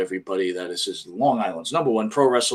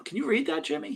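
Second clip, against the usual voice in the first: much higher, thin and pleading; pleading for help; 7 dB quieter, faster than usual. Real, not acted. Host begging his co-host to read the screen, much higher and pleading. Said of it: "Help me".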